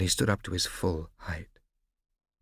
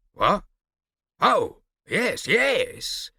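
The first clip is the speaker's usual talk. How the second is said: flustered; 12 semitones higher, 7 dB louder, much slower than usual. Acted. Barbarossa caught off guard.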